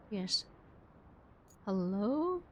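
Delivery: ordinary delivery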